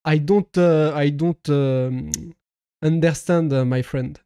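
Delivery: hesitant English in a French accent. Real, not acted. Streamer not understanding a foreign viewer, hesitant French-accented English. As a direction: grope through it with French vowels. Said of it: "de mon accent anglais".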